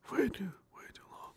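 whispered, drifting off. Real, not acted. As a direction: breathe it as a tired aside. Streamer whispering while wrapping up.

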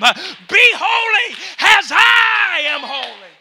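fervent revival preacher shouting; full-force preaching. Real, not acted.

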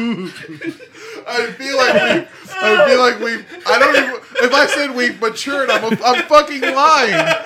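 high, loud, laughing through the words; can barely talk for laughing. Real, not acted. Helpless laughter while talking.

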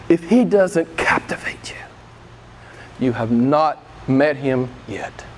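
slow, breathy whisper; sudden whisper. Real, not acted. Preacher whispering to his congregation, slow and hushed.

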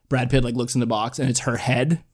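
normal talking voice

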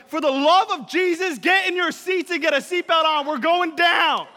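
loud frantic flight attendant; panicked yell, slowing on going down; panicked announcement. Retold or imitated. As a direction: shout it like the plane is falling. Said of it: "flight attendant gets on the thing".

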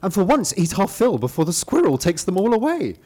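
high, excited rooster voice. Acted. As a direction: play the cock, eager and high. Cock character voiced.

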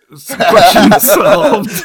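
loud laughing; laughing hard. Real, not acted.

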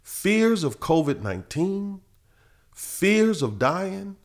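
high, drawn-out preacher's cadence; fervent preaching. Real, not acted. Preacher assures listeners of deliverance from fear, in soaring, drawn-out preaching. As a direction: stretch each phrase and build to a loud peak.

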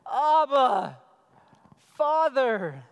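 loud calling cry to a father. Acted. Preacher voicing believers crying out loud to God. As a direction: call out Abba like a child crying to its father. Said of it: "by whom we cry".

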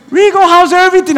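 high, loud hail to an acquaintance; mock eager greeting. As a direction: imitate an overfriendly hello. Acts a stranger greeting him warmly.

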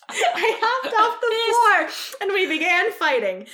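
high, loud laughing through words; giggling while talking. Real, not acted. Cracking up at the hiss.

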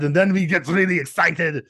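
excited action-star impression, high and loud; Arnold. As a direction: get worked up in the movie star's accent. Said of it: "the different register that Arnold has".

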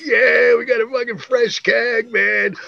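high-pitched mock group cheer, stretched out; whooping celebration. Pals celebrating a stolen keg, imitated excited whoop. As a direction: crow it like drunk pals scoring big. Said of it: "We're all laughing our asses off".

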